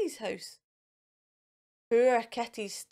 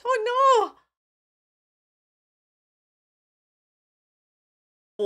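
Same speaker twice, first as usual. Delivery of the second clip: high, loud cry of dismay; reader's big oh no at the splash; 11 semitones higher, 6 dB louder than usual. Acted. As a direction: gasp it out in mock alarm. Splash soaks the pool.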